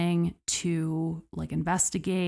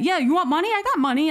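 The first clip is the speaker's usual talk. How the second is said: much higher, louder, a hurried eager offer; 9 semitones higher, 5 dB louder, much faster than usual. Retold or imitated. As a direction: sell it fast to calm a gunman. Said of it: "And, like".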